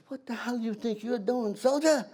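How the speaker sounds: high, rising mock officer's scolding. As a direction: bark at a private soldier. Surgeon major scolding private.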